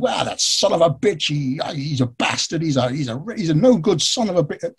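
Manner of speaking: imitated angry rant. Retelling the enraged man's phone reply, angry rant. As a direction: act out a man sputtering with outrage. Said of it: "He goes off on this rant, this angry".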